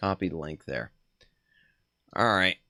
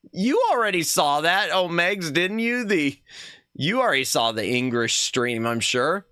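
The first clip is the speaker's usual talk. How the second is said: usual speaking voice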